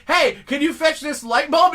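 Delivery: high, loud, chirpy demand; mock boss request. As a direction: play the pushy boss asking a favor. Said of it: "they say".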